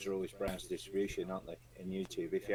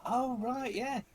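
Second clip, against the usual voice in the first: surprised recognition; 5 dB louder, much slower than usual. Retold or imitated. Driver recognises the Browns name.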